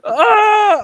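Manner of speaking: very high, loud cry of pain; sudden piercing yell; imitated scream. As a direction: mimic a man screaming in agony. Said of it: "He's like".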